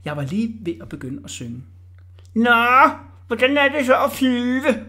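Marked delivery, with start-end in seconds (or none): 2.3-4.8 high, loud jeering call; spiteful shout. A narrator's reading. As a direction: holler it up mockingly. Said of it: "råbte Orla ondskabsfuldt".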